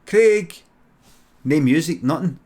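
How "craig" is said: loud, high call into the house. Retold or imitated. Cousins at the door calling for Craig, loud. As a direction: call his name through the open door.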